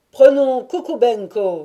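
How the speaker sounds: loud, high proposal called out; crowd cry. A narrator's reading. A few Cossacks putting up Kukubenko, crying out. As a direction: shout a nomination over the crowd. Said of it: "s'écrièrent quelques-uns".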